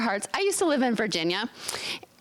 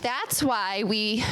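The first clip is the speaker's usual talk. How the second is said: normal talking voice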